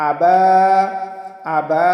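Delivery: "আবা" with the final a held one count; short madd. Shown as a demonstration. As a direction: chant "আবা", stretching "বা". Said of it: "বাতে এক আলিফ টান". ("আবা"-র "বা"-তে এক আলিফ টান)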